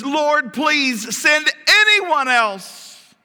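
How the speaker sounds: very high, loud, drawn-out begging; desperate plea. Acted. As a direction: beg at full voice, stretching the words. Moses begs God to send another.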